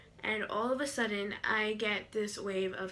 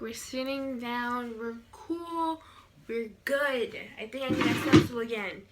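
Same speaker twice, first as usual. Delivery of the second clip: ordinary talk, nothing marked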